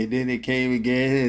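ordinary talk, nothing marked

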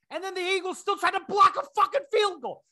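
high-pitched incredulous yelling; exasperated rant. Acted. Fan fuming that his team fell for it, exasperated. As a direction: cry out at the sheer stupidity. Fan's rant at team's blunder.

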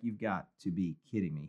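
low, soft eye-roll mutter; deadpan disbelief under the breath; exasperated aside. Retold or imitated. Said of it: "You're just like".

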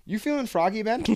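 high mock challenge; imitating mom. Mom challenging her son's friend in a high voice. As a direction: pitch it up as the teasing mom. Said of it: "she goes".

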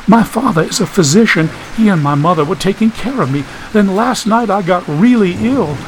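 put-on high-pitched young woman's voice; distressed sick girl. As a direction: recount the illness in a high, troubled voice. Voices Millicent telling of illness.

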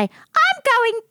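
put-on dotty old woman. Old resident voiced in story.

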